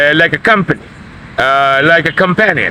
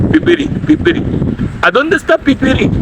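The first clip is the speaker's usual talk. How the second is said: higher, quick "pipiri" street question; laborer slang; 10 semitones higher, faster than usual. Shown as a demonstration. Laborer asking a buddy where the food is. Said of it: "When two laborers talk".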